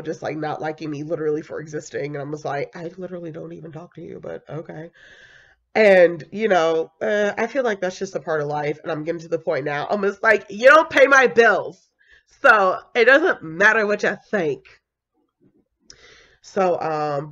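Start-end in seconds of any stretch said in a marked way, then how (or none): 2.8-4.9 much softer, fast dry aside; soft fast comeback; under-breath retort. Retold or imitated. Brushing off people who dislike her. 10.5-14.6 higher, louder sassy comeback; louder, defiant retort; sassy dismissal. Retold or imitated. Dismissing critics.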